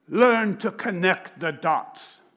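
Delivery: "Learn to connect the dots" slow, weighty and British-tinged; rabbi's command. Retold or imitated. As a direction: lay down "Learn to connect the dots" slowly, as a decree. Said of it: "he sounds like him".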